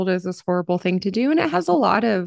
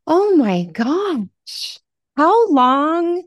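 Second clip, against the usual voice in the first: amazed; 7 semitones higher, 4 dB louder, much slower than usual. Real, not acted. Moved by guest's story.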